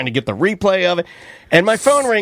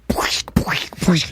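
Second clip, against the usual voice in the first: whooshed wet footstep sounds; voiceless squelching sound effect; imitated splashing; 2 semitones lower, 4 dB quieter, much slower than usual. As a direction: puff out soft splash noises.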